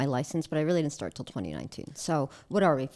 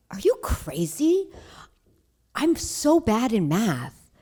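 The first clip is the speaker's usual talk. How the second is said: slow, drawn-out and louder, as if the idea is absurd; incredulous refusal; 5 semitones higher, 6 dB louder, slower than usual. Retold or imitated. Telling her dad to do real estate, slow, loud disbelief. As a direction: stretch every word out in disbelief. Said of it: "And I'm like".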